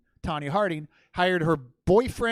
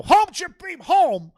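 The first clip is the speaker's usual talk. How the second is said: horse command, much higher and louder; mock command; 10 semitones higher, 10 dB louder, slower than usual. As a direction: call out to the horse grandly.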